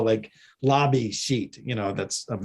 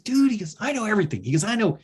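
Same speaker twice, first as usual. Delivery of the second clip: geeky fanboy; 8 semitones higher than usual. Retold or imitated. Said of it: "like, a total geeky fanboy".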